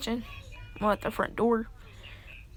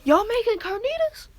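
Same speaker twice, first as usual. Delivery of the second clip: high, loud call across the house; 12 semitones higher, 5 dB louder than usual. Acted. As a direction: shout the question to the kitchen. Calling out to people in house.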